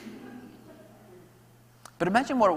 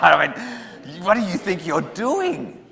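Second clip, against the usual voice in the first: louder, slow furious scolding; angry parent; 6 semitones higher, 9 dB louder, much slower than usual. Acted. Parent to joyriding teen at the cop shop, furious. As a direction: demand it of the teen, loud and slow.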